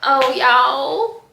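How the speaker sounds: ordinary delivery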